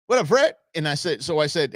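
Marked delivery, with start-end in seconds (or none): 0.1-0.5 high, loud bro-ish "What up, Fred?"; jock greeting. Retold or imitated. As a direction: greet him like a cocky frat guy. Golfer greets him.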